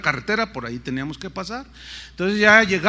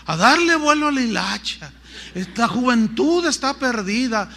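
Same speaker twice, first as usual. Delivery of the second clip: a hint of a disapproving older nurse, louder; scolding nurse; 5 semitones higher, 6 dB louder than usual. Nurse scolds the young preacher, mock disapproving. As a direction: tut at wayward youth.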